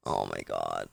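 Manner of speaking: low, faint, fading voice; dying words. Pretend. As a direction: sound like you're about to pass out. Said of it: "I'm pretending like I'm dying".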